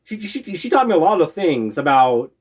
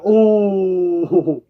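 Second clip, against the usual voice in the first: long, drawn-out mock "oh"; exaggerated stunned "oh"; retold shock; 3 semitones higher than usual. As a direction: replay being taken aback.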